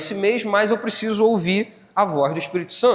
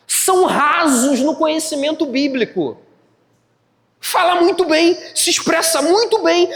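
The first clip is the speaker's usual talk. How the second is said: high, raised preacher's voice; full-force preaching; 9 semitones higher, 4 dB louder than usual. Real, not acted. Preacher warning church about shallow speakers, impassioned. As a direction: drive each point home emphatically, high and forceful.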